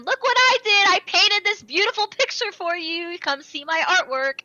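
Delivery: high, loud, excited mock boast; gleeful villain. Villain taunting Itadori with his artwork, gleeful and loud. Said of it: "he's laughing as he's doing it, having so much fun".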